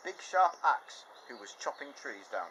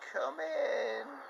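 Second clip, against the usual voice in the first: much higher fake granny voice; wolf as Grandma. Wolf disguised as grandma, high fake voice. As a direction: pretend to be a frail grandma. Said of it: "And the wolf said".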